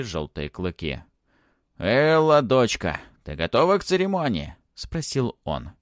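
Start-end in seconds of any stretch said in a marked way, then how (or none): 1.8-4.5 baron greeting daughter. A narrator's reading. Baron asks his daughter about the ceremony, calling out. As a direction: hail your daughter with a lifted, questioning call.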